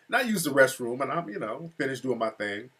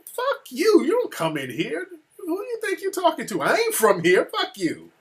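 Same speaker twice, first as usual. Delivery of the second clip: high-pitched indignant tirade, dropping at the end; imitated cussing wife; 11 semitones higher than usual. Wife back at the venue owner, imitated cussing. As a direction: mimic a wife telling someone off. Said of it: "my wife is cussing him out".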